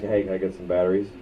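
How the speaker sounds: low, quick and offhand; casual to a guy. Retold or imitated. Customer asking a male clerk for batteries, casual. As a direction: toss it off casually, pitched low. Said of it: "if she had been a, a guy, I would've just".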